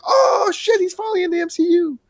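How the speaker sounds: very high, squealed mock excitement; acted falsetto fanboy glee; thrilled fan reaction. Acting out a thrilled viewer's reaction.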